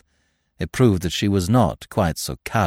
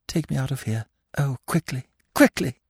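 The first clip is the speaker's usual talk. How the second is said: soft and faint, breaking higher on the last quickly; desperate plea to get out, building; cried, shuddering; 5 semitones higher, 3 dB quieter than usual. A narrator's reading. Shaken girl begs the narrator to take her out, crying out. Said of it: "she cried, shuddering".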